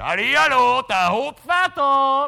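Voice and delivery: loud, high drawn-out hallo; calling out. Retold or imitated. Hopf calling into the empty afterlife, loud drawn call. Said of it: "wenn ich geschrien".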